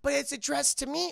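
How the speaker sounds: high, innocent protest; Olive. Retold or imitated. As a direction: object meekly in a high voice. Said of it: "And she's like".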